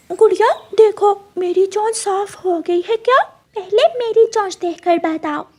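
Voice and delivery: a chick begging to be checked; eager child bird. Acted. Brother asking sister about his beak, eager.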